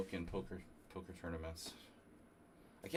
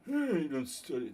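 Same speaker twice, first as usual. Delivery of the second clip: a higher, louder put-on voice that slips toward Cookie Monster; botched impression; 7 semitones higher, 8 dB louder than usual. Streamer's grandmaster impression accidentally sounds like Cookie Monster. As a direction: bark it in a comic voice that misses the mark. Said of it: "that's Cookie Monster".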